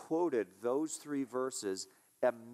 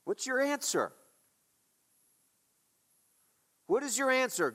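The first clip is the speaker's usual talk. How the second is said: much higher, demanding an answer; pressing question; 10 semitones higher than usual. Acted. Pastor demanding an answer from the congregation, much higher. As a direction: push them to answer now.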